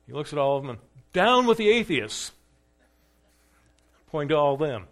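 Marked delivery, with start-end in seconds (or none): 1.1-2.3 high, loud shout flung at the crowd; defiant shout. Acted. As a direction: hurl it back at them, pointing. Polycarp turns words on crowd.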